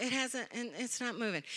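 very soft, unbothered answer; quiet deadpan reply. Retold or imitated. Spouse in bed answering frantic pastor, very quiet calm reply. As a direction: drop right down, unimpressed.

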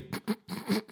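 breathy high giggle. Real, not acted. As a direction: burst out giggling at the text. Reader cracks up mid-sentence.

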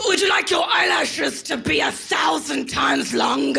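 chastise like a posh British ad; English accent ad voice. Retold or imitated. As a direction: overblown English accent pitch. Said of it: "English accent chastises you".

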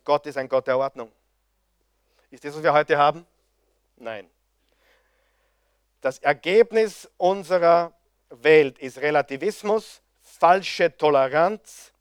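ordinary talk

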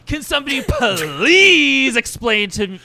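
high, loud, exasperated villain; Mister Sinister. Acted. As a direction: stretch out an indignant plea, loudly. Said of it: "Mister Sinister is now Skeletor".

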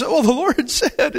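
high, giggling mock gush of joy; giggly excitement. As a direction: gush like a giggly young girl. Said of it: "and she's all excited".

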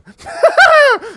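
sudden high cackle; maniacal laugh. Retold or imitated. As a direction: burst into a crazed laugh. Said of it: "your cannibal is also just randomly going".

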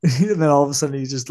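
normal talking voice

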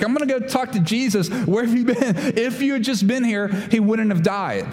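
mock exasperated reproach; ticked-off Martha. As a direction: fire off the complaint like an upset sister. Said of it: "Martha's ticked off".

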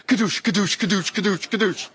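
rhythmic imitated foot pump; mimicked air pump; pump noise. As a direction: whoosh each kadoosh like pumping air. Doctor pumping numbing agent.

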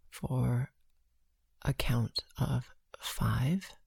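slow, hushed breath counting. Acted.

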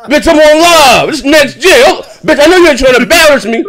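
high, loud hammed-up Chad; mock Chad scolding. Chad embarrassed on live, high loud mock scolding. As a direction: play Chad hissing at his girl mid-stream, laughing.

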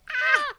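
high, loud mock scream of fright; hammed-up frightened yelp. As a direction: shriek like a startled cartoon crocodile. Both characters scared.

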